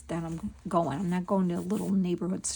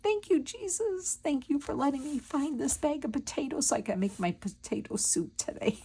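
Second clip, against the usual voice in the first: high, sing-song thank-you to heaven; mock praise, voice lifted way up high; grateful praise; 7 semitones higher than usual. A shopper thanking Jesus for the potatoes, in a high sing-song. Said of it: "I said".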